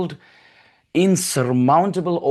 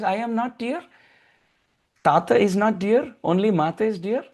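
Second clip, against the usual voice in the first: higher, mock-hurt complaint; father teasing the baby, mock hurt; playful sulking father; 8 semitones higher than usual. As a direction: pretend to be left out, teasing.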